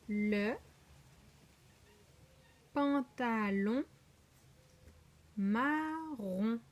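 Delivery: "pantalon" and "marron" stretched syllable by syllable; drawn-out syllables, "pantalon" and "marron" lifting at the end; slow sounding-out. Shown as a demonstration. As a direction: read slowly, pausing after "Le" and "pantalon". Teacher sounding out words.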